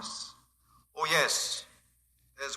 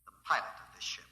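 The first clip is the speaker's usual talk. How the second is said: whisper; 6 dB quieter than usual. Acted. Captor reveals secret.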